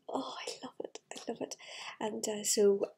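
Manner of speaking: ordinary delivery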